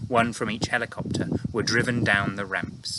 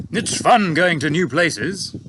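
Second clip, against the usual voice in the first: slow, louder, relishing the adventure; eager pilot; 6 semitones higher, 7 dB louder, slower than usual. A narrator's reading. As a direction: pilot telling his boss adventure is fun, slow and eager. Pilot voiced in read story.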